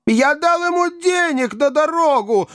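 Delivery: very high-pitched hysterical cry; frantic shouting. A narrator's reading. Duped gentleman leaping up over his lost money, frantic shouting. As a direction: shriek it like a madman, pitch way up. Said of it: "закричал мистер Тапман".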